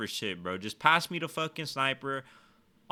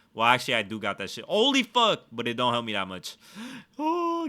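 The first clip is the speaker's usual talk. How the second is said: ordinary talk, nothing marked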